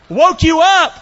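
high, loud burst at a listener; loud wake-up call. Real, not acted. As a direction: startle the congregation awake.

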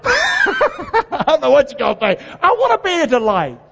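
high laughing aside. Real, not acted. Preacher laughing at his own odd word, high laugh. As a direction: laugh it off, amused at yourself.

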